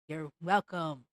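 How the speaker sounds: low, snide "you're welcome"; mock sarcastic. Mocking Disney's excuse.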